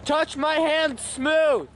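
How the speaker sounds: slow, high calling voice; talking to a ghost. Real, not acted. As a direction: draw the words out to the spirit. Addressing spirits.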